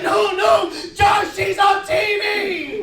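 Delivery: put-on high, insistent kid calling out; alarmed little brother. Little brother correcting Josh from the room, alarmed. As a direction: stretch the last words high in alarm.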